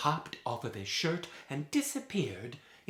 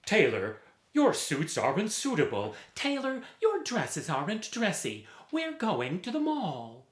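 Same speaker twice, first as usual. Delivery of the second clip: high, loud scoffing customers; 7 semitones higher, 4 dB louder than usual. Acted. Customers tell the tailor they go to the mall, scoffing. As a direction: sneer at the tailor and walk off.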